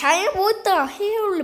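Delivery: ordinary talk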